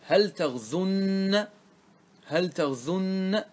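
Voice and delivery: تغزن as taghzunna, very slow; emphatic nun. Shown as a demonstration. Teacher recaps the rule to students, drawing out the nn in تغزن. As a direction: dwell on the doubled n of تغزن. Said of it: "فقلنا إيش؟".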